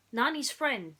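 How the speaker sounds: usual speaking voice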